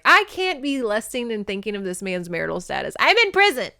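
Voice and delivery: hammed-up exasperation, pitch leaping at the end; exasperated inner outburst. Heroine scolding herself for lusting in prison, exasperated. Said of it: "And then she's like".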